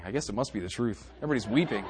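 ordinary talk, nothing marked

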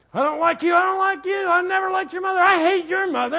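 high, loud hammed-up shouting; mock spouse rant.

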